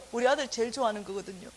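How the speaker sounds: ordinary talk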